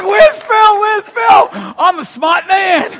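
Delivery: usual speaking voice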